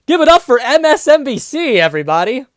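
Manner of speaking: loud, high hype-man call; mock announcer. Reader sarcastically cheering MSNBC, loud mock announcement. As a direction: shout it like a game-show host.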